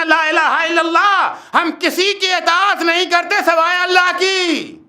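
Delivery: high, ringing declaration of faith; forceful preaching. Real, not acted. Preacher declaring obedience to God alone, forceful preaching. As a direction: proclaim it to the whole congregation.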